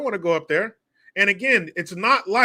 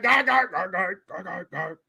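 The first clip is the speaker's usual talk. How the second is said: fading doll cackle; 2 semitones higher than usual. Acted. Doll being turned down, mock cackle fading out. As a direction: fake cackle dying away as volume drops.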